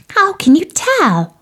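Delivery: put-on high, eager child's question; little tadpole voice. Curious tadpole asking Bertie about the rabbit, high and eager. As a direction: ask it wide-eyed like a tiny tadpole.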